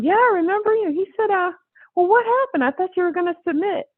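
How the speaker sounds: high, eager insistent man; excited Steve. Retold or imitated. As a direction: press her keenly, pitch way up. Steve quoted, pressing her.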